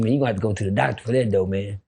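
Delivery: quick low advice from a big homie. Retold or imitated. Older friend telling him to see a doctor, low and quick. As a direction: rush it out low and casual. Kenneth Wayne advising him, voiced.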